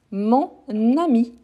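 "mon", pause, then "ami" starting on n: nami; separated liaison. Shown as a demonstration. Teacher demonstrating a well-known example, "mon... nami" split to show liaison. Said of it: "Quand j'utilise les mots ami".

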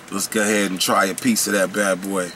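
ordinary talk, nothing marked

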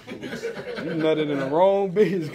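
slow, laughing punchline. Real, not acted. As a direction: drag it out while laughing.

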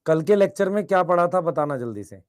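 ordinary talk, nothing marked

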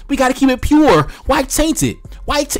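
high-pitched mock pleading; put-on purist whine; mocking imitation.

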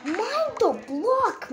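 slow high whining stretch; drawn-out pleading. Real, not acted. Player begging the game, slow high whine. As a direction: stretch every word in frustration.

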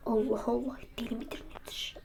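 soft unvoiced whisper; whispered aside. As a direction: say it under your breath. Muttering about the other.

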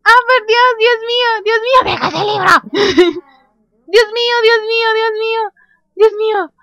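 high, loud squealing; overexcited. Real, not acted. Gamer finally sees the aliens, squealing with excitement. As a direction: exclaim breathlessly over and over, overwhelmed with joy. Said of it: "me emocioné muchísimo".

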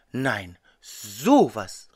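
slow, low, drawn-out amazement; astonished exclamation. A narrator's reading. Beetle learning he has met the elf, astonished. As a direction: stretch it out in astonishment. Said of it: "rief der Käfer".

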